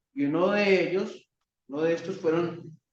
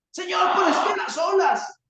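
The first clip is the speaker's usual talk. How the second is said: high, loud complaining excuse; whining excuse; 12 semitones higher, 4 dB louder than usual. Pretend. Peter excusing himself to the Lord: high whining shout. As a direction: plead an excuse to the Lord, higher. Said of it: "Él pudo decir".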